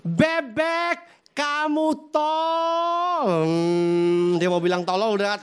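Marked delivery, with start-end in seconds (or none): 0.0-3.2 husband's voice higher, the last words dragged very slowly; boiling husband. Acted. Husband to wife, about to call her stupid: high, slow, seething. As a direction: hold back the insult through rising anger. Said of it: "Naik spanning, mendidih".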